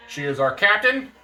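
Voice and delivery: ordinary talk, nothing marked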